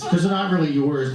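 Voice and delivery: ordinary delivery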